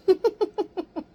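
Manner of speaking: loud high burst of laughter; real laugh. Real, not acted. Laughs after toast.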